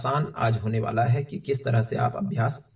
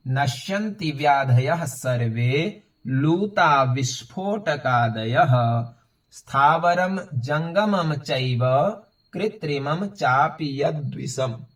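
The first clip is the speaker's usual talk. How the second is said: measured, unsung Sanskrit recitation; slow full read-through; slower than usual. Shown as a demonstration. Teacher reads the easy shlok through for learners, slow recitation. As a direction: read each phrase slowly, vowels lengthened.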